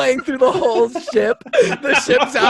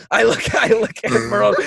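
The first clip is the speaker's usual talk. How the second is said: ordinary talk, nothing marked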